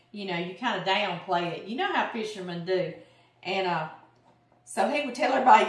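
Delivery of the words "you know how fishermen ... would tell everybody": ordinary talk, nothing marked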